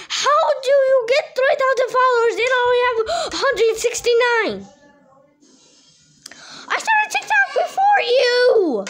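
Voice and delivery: high, loud whining complaint; jealous whiny outburst. Acted. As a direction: whine it out jealously. Puppet rivalry, jealous outburst.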